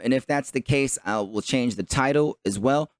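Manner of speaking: ordinary delivery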